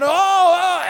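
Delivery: mock exasperated cry.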